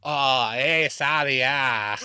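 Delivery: imitating a Massachusetts accent; Boston accent. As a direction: put on a drawn-out Boston accent. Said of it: "I just want Boston".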